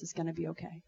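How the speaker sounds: hushed reassurance to a weeping woman; gentle reassurance. Retold or imitated. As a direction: say it like a stranger soothing you. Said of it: "she just said".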